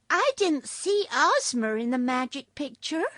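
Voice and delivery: put-on little girl voice. A narrator's reading. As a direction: ask like an eager young girl. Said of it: "said Trot".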